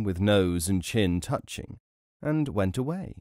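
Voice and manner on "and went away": ordinary delivery, nothing marked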